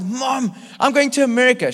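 high-pitched, hammed-up tearful phone call; mock tearful. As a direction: cry it to mom in a high voice. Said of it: "I'm weeping".

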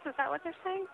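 normal talking voice

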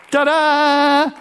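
high, loud showman's ta-da; ta-da flourish. Acted. Preacher to congregation, a playful high ta-da. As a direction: pop it like a magic reveal. Punchline flourish after teaching.

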